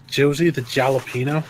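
"Josie the Jalapeno" with hard English J sounds; anglicized pronunciation. Real, not acted. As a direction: say "Jalapeno" with J as in jam, questioning and low. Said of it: "where'd you learn how to read Spanish".

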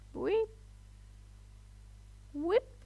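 high rising whip sound; jump sound effect. Pretend. Voicing her own jumps, a high whip noise. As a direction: make a playful whoosh noise.

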